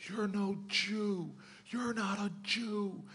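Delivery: slow, high-pitched mock scream at no added volume; hushed-volume imitation of screaming; retold angry scream. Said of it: "starts screaming at him and saying".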